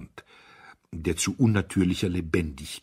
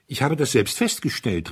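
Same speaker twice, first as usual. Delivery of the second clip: higher and louder, eager; called out; 6 semitones higher, 5 dB louder than usual. A narrator's reading. Young duke pushing in, calling out eagerly. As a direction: boyish call into the group. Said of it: "rief der junge Herzog".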